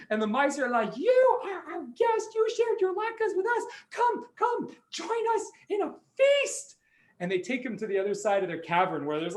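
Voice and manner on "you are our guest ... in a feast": high-pitched little mouse voice; welcoming like a tiny host; squeaky mouse voice. Acted. Mice welcoming guest.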